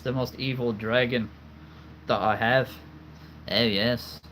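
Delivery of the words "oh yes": usual speaking voice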